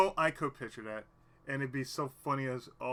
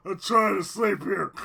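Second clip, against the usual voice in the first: loud mock complaint; 5 semitones higher, 11 dB louder than usual. Mock complaint then laugh.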